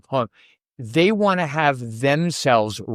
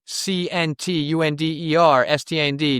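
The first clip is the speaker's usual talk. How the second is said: each letter of C N T U N D E R S T A N D said separately and evenly; podcast host spelling the email address letter by letter, evenly; 2 semitones higher than usual. Shown as a demonstration. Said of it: "Can't understand normal thinking at gmail dot com".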